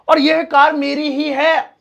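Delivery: "और यह कार मेरी ही है" slow, leaning on ही; slow, insistent claim of ownership; emphatic ही. Shown as a demonstration. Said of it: "और भी ऐसे बहुत सारे तरीके".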